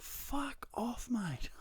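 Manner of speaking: high, strained mock yell; mock angry outburst. Retold shooing cat from his bed.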